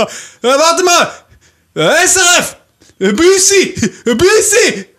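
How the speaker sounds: put-on high loud drunk call from far behind. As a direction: yell after him laughing. Drunk guy calling from behind. (lautes, hohes Nachrufen)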